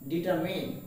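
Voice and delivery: Determine ending in "min"; correct pronunciation. Shown as a demonstration. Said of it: "The word is determine".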